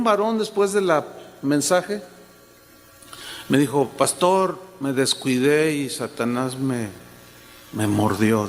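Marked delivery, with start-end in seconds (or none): 4.0-8.5 voz baja y grave de hombre arrepentido; lloroso, imitado. Retold or imitated. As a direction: imita una confesión dolida, en tono bajo. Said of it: "estaban llorando los dos". (imitando un llanto contenido, voz apesadumbrada)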